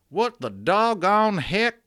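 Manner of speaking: slow, high, loud cowboy drawl; cowboy accent. Acted. Amazed cowboy looks up at the giant hands, cowboy accent. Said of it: "attempt at a cowboy sounding accent".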